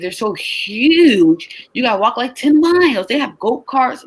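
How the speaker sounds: usual speaking voice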